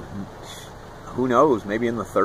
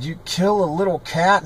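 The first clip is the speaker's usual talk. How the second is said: higher, incredulous question; appalled question; 8 semitones higher than usual. Retold or imitated. Boy confronting Frank over the cat, appalled. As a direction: retell it in disbelief.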